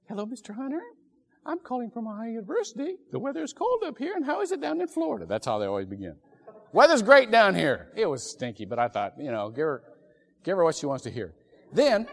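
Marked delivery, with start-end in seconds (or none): 0.1-5.3 high, bright-sounding caller voice pitched well up; lightly imitated sweet caller, high and quick; sweet little voice. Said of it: "this sweet little voice". 6.7-7.9 hearty loud reply. Retold or imitated. His reply to the caller.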